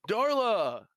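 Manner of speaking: loud, high drawn-out name; placating call. Acted. Man opening the door to greet Darla, loud high call. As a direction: sing out the name, rising then falling. Man calls out to angry woman.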